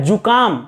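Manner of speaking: जुकाम alone, ju-kaam, falling; unaspirated क and long आ in जुकाम; careful corrected जुकाम as a set-apart answer; plain क. Shown as a demonstration. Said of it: "सही क्या है पता है?".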